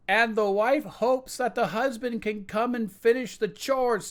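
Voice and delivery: high, called-out wife voice; wife calling from the back about chores. Acted. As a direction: call it from the back of the room. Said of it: "kind of from the back".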